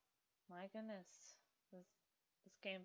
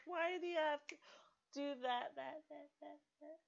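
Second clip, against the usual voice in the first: exasperated; 7 semitones higher than usual. Real, not acted. Scolding the game character.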